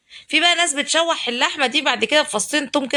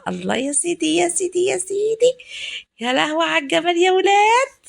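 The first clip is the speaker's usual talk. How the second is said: rising delight; 7 semitones higher than usual. Real, not acted. Delight at the sizzling vinegar.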